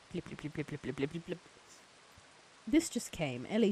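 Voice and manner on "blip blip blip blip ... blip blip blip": soft, low repeated blipping; quiet rapid mouth noises; mock ASMR blips. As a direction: burble little blips close to the mic.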